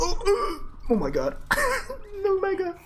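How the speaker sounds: very high squealing laugh; high gasping giggle fit; shrieking laughter. Real, not acted. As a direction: crack up in disbelief. Laughing at gameplay.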